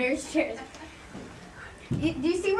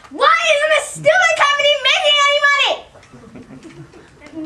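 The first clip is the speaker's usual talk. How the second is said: much higher, louder angry shout; furious outburst; 9 semitones higher, 7 dB louder than usual. Acted. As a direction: explode at the useless staff. Boss explodes about no profit.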